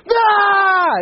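sudden high, loud "Ah!" to frighten; scare roar. Retold or imitated. Older brother in the mask scaring his little brother with a loud shout. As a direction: jump out with a big shout. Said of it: "And I go".

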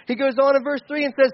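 normal talking voice

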